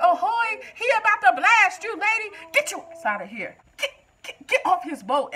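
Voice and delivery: high, loud frantic yelling; panicked high yell, as if the TV could hear. Retold or imitated. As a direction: shout a warning at the screen. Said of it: "I'm yelling at the T V".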